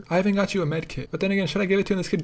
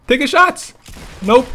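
high, loud urgent yell; panicked yell; 7 semitones higher, 6 dB louder than usual. Real, not acted. Player under fire, urgent yelling. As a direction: yell it in alarm.

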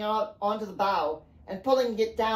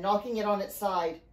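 plain reading voice

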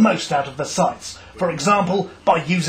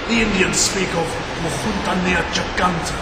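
mock documentary narration. Documentary presenter voice.

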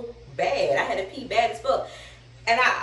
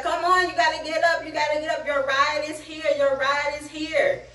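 tag-less hurrying; 6 semitones higher than usual. Retold or imitated. Imitates staff hurrying her up.

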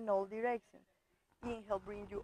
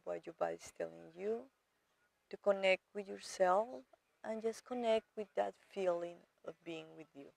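soft, lowered, unhurried meditation voice; guided meditation; 3 semitones lower, 3 dB quieter than usual. Real, not acted. Yoga teacher guiding students to arrive to their body, calm meditation voice. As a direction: settle the listener gently, with pauses between phrases.

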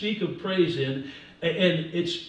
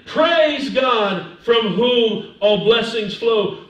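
loud, slow declaration; emphatic preacher's proclamation, drawn out; spoken praise; 3 semitones higher, 6 dB louder, much slower than usual. Shown as a demonstration. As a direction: declare it phrase by phrase. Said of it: "So when you're saying".

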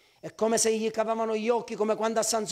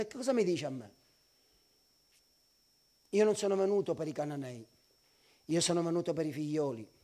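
low, soft dismissal; subdued low-voiced refusal; understated rebuff; 6 semitones lower, 5 dB quieter than usual. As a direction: answer quietly and coolly, as if unmoved by the plea. Jesus coolly rebuffs the pleading woman.

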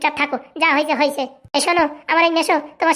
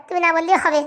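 ordinary talk

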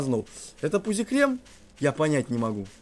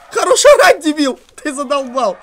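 "Хорош орать" shrieked much higher and louder; furious scream; 9 semitones higher, 8 dB louder than usual. Real, not acted. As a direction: scream it back at full force. Repeats, even louder.

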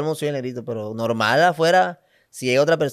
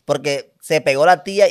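ordinary delivery

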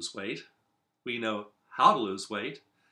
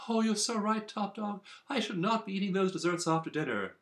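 high, quick, simpering agreement; fawning underdog; 9 semitones higher, faster than usual. Acted. Underdog eagerly agreeing with top dog, high fast fawning voice. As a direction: gush up high, eager to please the boss. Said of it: "Underdog says".

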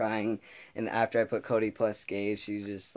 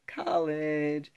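higher, bright announcement; excited reveal; 7 semitones higher than usual. Real, not acted. Vlogger announcing what comes next, higher and excited. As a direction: pop the big news. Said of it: "you know what's next?".

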